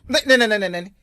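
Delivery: loud, high rapid-fire repetition; alarmed objection. Acted. Car owner stops a passenger, alarmed. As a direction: cut them off in loud alarm.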